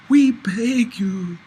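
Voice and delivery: slow, high, hammed-up begging; mock pleading. A narrator's reading. Angel kneels between seats, pleading for poetry. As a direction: beg theatrically, drawing it out.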